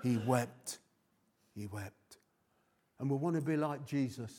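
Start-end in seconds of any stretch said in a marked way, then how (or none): 1.5-2.0 softer, trailing away; hushed echo. Real, not acted. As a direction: let it fade to a hush. Repeating for effect.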